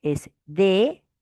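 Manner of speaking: "de-sa-mor" slowly, a pause between each syllable. Shown as a demonstration. Teacher shows the correct split of desamor, slowly. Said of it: "la manera correcta de separar".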